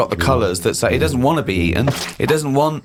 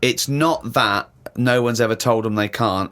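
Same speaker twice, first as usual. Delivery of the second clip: normal talking voice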